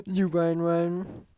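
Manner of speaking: high, sustained call. Acted. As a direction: pitch it up and hold it high. Retake after cracking up.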